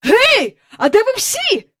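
high, loud call through the house; excited father's shout. A narrator's reading. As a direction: holler for the whole family. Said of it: "гукнув він".

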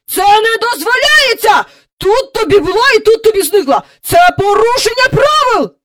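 high, loud, indignant shouting; outraged hollering. A narrator's reading. Park keeper scolding a vanished carousel for breaking rules, outraged shouting. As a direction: protest at the top of the voice. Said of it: "загаласував він".

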